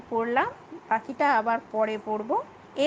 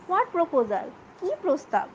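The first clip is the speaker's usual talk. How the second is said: surprised; 4 semitones higher than usual. Acted. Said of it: "অবাক হয়ে বলছে".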